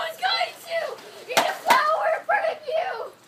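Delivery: high, loud yelled protest; playful shout. Real, not acted. Kid shouting at friends on the ladder, playful shout. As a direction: yell it across the yard.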